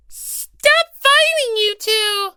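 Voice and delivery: very high, slow, squeaky cry; a little mascot pleading them to stop; hasty mascot plea. Acted. Monami breaking up the quarrel in a high squeaky cry. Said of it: "cut in hastily to try and break them up".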